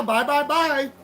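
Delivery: cocky singsong bye; mocking goodbye. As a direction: wave them off with a taunt. Taunting dismissal.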